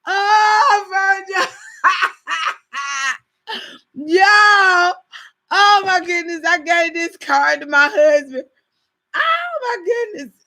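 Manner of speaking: half shout, half laughing sob; laughing hard. Real, not acted. Wife to viewers, crying with laughter over the mixed-up card.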